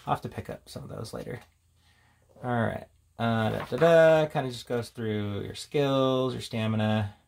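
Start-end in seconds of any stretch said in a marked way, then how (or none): none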